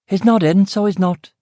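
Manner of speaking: irritated West Belfast housekeeper at the door; pissed-off West Belfast accent. Acted. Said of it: "she said in a pissed-off West Belfast accent".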